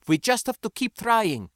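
high-pitched, tense insistence; irritated and fearful. A narrator's reading. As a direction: push it out high, annoyed but scared. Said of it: "in irritation and fear".

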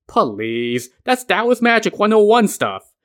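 scoffing, rising in pitch; dismissive. A narrator's reading. Son belittling his father's Taoist magic, dismissive. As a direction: wave it off as beginner stuff.

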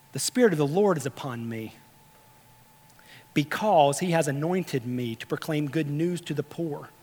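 low, soft reading from the scroll; solemn scripture reading. Acted. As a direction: read it out quietly as Jesus in the synagogue, with long pauses. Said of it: "And here's what he reads".

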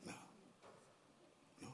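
ordinary delivery

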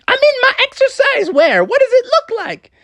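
very high, squeaky mom voice; Froggy's mom. Acted. Mom calls after the family to wait, high-voiced. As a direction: pitch it way up for an eager mother. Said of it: "And she's like".

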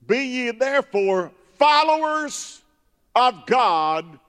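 high, loud scripture shout; full-force preaching. Real, not acted. Preacher hitting chapter five verse one, loud shouted declamation.

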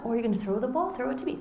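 put-on high sing-song voice for a toddler; baby talk. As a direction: coo like a parent praising a tot. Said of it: "the parent is talking to them".

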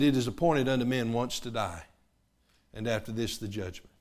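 ordinary talk, nothing marked

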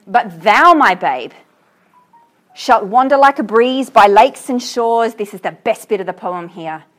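loud, slow, swelling recital; impassioned poem reading. Acted. Teacher reaching the best part of the poem, loud slow declaiming. Reads poem aloud to class.

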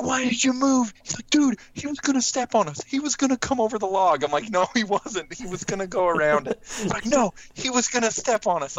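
high, heated back-and-forth; two scared boys bickering; kids' squabble. Retold or imitated. Argument after bull fled.

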